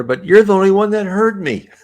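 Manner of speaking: laughing. Retold or imitated. Spirit's answer ending in laughter.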